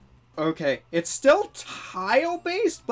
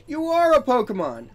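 astonished; 9 semitones higher, 9 dB louder than usual. Acted. Reads astonished dialogue.

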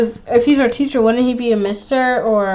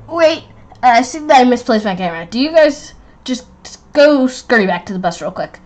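normal talking voice

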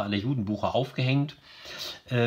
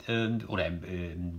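normal talking voice

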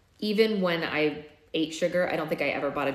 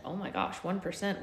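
plain reading voice

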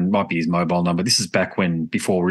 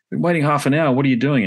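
higher, mock-annoyed grumble; impatient complaint; 7 semitones higher than usual. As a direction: imitate a guest fed up with waiting. Angry guest on phone, retold.

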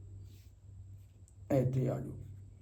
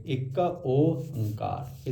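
ਇੱਕ Onkar slow and softer, in three parts; three separate parts; 6 dB quieter, much slower than usual. Shown as a demonstration. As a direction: softly separate each part of ਇੱਕ Onkar. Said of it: "ਇਹ ਤਿੰਨ ਹੋ ਗਏ".

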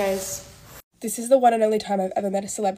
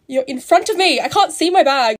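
loud and higher, exasperated at the guy cutting in; annoyed outburst; 5 semitones higher, 10 dB louder than usual. Retold or imitated. Vlogger reliving the man who blocked her bag, loud and exasperated. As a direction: blurt it out, fed up. Man cuts in at baggage carousel.

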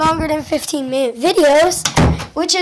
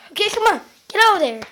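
ordinary delivery

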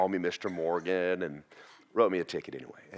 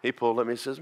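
ordinary delivery

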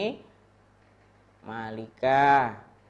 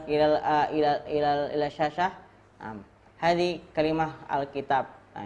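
ordinary delivery

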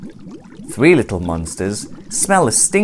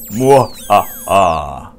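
slow, louder evil mwah-ha-ha; mad scientist laugh; 3 semitones lower, 5 dB louder, much slower than usual. A narrator's reading. Scientist gloating as robots wake, slow evil laugh. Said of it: "the scientist laughs".

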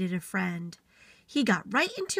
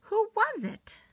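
small beaver voice; 12 semitones higher than usual. Acted. Little Beaver asks, high small voice.

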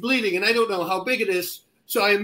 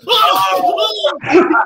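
high, very loud imitated screaming; mock scream; 12 semitones higher, 10 dB louder than usual. Fighter retells his opponent freaking out, imitated screaming. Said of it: "He starts screaming".

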